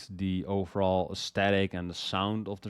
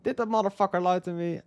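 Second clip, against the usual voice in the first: quick, much higher incredulous question; mock disbelief; 10 semitones higher, faster than usual. Reviewer wondering if the rapper lied, quick mock disbelief. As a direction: toss it off fast in high mock outrage. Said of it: "I'm like".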